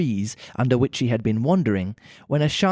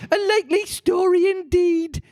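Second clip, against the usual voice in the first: high, scornful bird voice; contemptuous pigeon; 3 dB louder than usual. A narrator's reading. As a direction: sneer it down at her. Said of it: "in a tone of deepest contempt".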